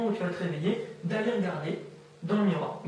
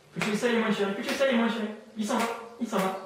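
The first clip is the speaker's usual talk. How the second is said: loud, raised repetitive chant; insistent suggestion; 4 semitones higher, 3 dB louder, faster than usual. Real, not acted. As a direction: press the suggestion hard, higher and louder. Hypnotist blocks the subject's answer. (martèlement fort et insistant)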